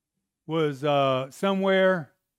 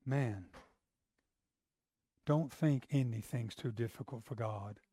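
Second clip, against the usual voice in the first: ordinary delivery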